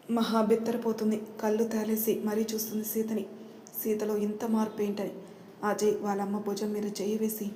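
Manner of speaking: ordinary delivery, nothing marked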